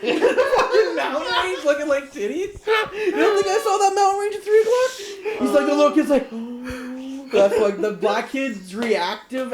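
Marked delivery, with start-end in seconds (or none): 0.0-5.4 high, crying with laughter; laughing quote. Retold or imitated. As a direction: squeal it through tears of laughter. Quoting the kid, laughing hard.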